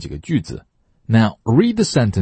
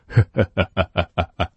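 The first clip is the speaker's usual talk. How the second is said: breathy ha-ha to show the h; 古装剧里的呵呵笑; demo breathy laugh. Shown as a demonstration. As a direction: laugh out from the throat. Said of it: "两个人相见不都是先笑吗".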